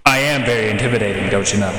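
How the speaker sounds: low, fast mock narrator voice. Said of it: "It's a narrator voice".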